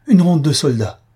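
wife crying out in alarm, loud and high; exclaiming. A narrator's reading. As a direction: cry out in alarm at the soldiers' steps. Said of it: "s'écria Albina".

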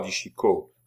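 usual speaking voice

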